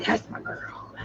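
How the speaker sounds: soft unvoiced whisper to the dog. Real, not acted. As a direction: breathe it to her, no voice. Praising dog, said twice.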